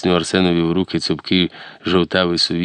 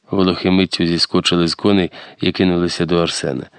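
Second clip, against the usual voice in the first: ordinary delivery, nothing marked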